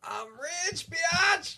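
high, shouted catchphrase. Pretend. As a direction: yell it like a gloating winner. Quoting a comedy catchphrase.

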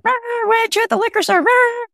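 very high mocking rah rah; mock cheering husband. Wife mocks her husband's cheerful call, very high and sing-song. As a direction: squeal it like a cheerleader chant. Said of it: "my husband calls and was like".

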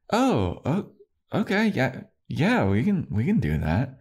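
high, squeaky surprised; a flustered high mock Tara; startled Tara.

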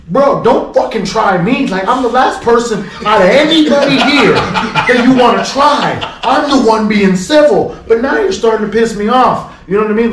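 loud, high, slowed warning; worked-up retelling, emphatic and forceful; exasperated pleading. Retold or imitated. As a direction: plead hard with patience running out. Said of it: "I'm pleading".

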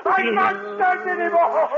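man losing his mind to the voice; hysterical screaming. Acted. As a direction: scream at the she devil to stop. Man screaming at she devil.

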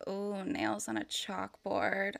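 slow, soft sultry purr; drawn-out mock-sexy coo; seductive. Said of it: "like, a seductive way".

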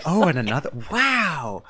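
mock wonder rising on the wow; lightly hammed-up amazement; endlessly surprised. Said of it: "endlessly surprised by how this sari works".

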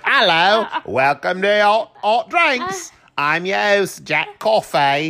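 hammed-up Jamaican-Cockney hybrid accent, pitched high; slow, weird Jamaican-Cockney mash-up accent; put-on accent. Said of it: "every episode is a different accent".

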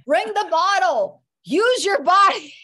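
high, loud, drawn-out mock yelling; mock argument shout. Friend re-enacts the couple's bottle argument, loud mock yelling. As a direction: play both sides of a shouted squabble.

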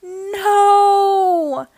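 long, high, loud held wail; slow-motion cry. A narrator's reading. Dad too late to stop Naughty Ninja, slow-motion cry. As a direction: stretch it out like a film in slow motion. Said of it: "cried Dad in slow motion".

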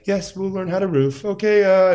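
high, loud, overeager yes; eager mock missionary. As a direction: jump at the chance like a keen missionary. Eager missionary accepting job.

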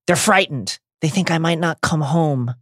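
acted tearful mother's voice. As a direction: plead as if through tears. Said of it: "answers through tears".

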